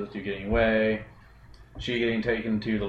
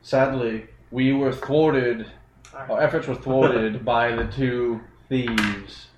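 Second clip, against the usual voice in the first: slow dramatic report; much slower than usual. Acted.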